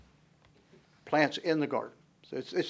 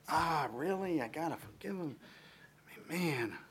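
grumbling under breath; 2 semitones higher, 10 dB quieter than usual. Retold or imitated. Reluctant believer complains.

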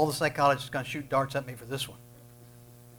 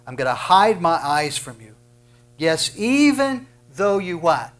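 preaching scripture; 4 semitones higher, 9 dB louder than usual. Acted. Preacher reading Isaiah to the congregation, building loud. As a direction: thunder out God's words to the people.

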